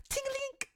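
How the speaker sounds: very high little metallic ting; imitated chain jingle. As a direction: mimic a tinkling chain sound effect. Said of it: "a chain sound effect".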